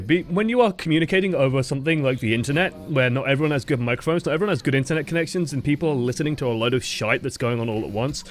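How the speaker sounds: ordinary talk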